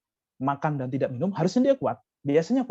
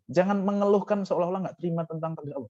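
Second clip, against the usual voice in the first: ordinary delivery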